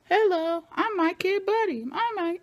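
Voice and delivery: high-pitched creepy talking-doll voice; Chucky. Retold or imitated. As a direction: squeak it like a recorded toy greeting. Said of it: "like some Chucky stuff".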